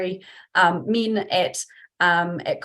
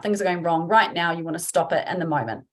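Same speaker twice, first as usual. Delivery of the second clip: ordinary talk, nothing marked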